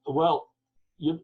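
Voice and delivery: ordinary talk, nothing marked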